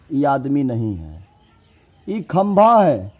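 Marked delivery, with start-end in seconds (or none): 2.1-3.1 high loud slow claim on खंभा; mock quiz claim. Shown as a demonstration. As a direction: announce it grandly, then deny it. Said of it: "नहीं भाई". (खंभा पर ज़ोर, ऊँचा और धीमा)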